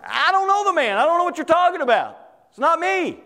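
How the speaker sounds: high-pitched, insistent acted denial; Peter denying. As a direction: protest quickly, pitched well up. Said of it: "And Peter goes".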